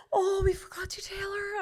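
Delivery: high gasp, then slow and high; gasping mock shock. Sisters gasping that they forgot to tell her. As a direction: gasp as if you just remembered. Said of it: "they were like".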